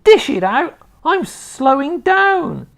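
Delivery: very high, very loud screechy crone whoop; wild old woman's shout. A narrator's reading. Grandma rising through the floor, screeching with glee. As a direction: whoop with mad joy.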